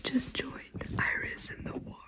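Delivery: hushed excited whisper. Real, not acted. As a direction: whisper it so no one hears. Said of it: "I have to whisper".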